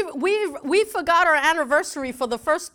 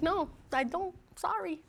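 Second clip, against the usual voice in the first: meek husband apology; 4 semitones higher, 9 dB quieter than usual. Retold or imitated. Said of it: "And it's like".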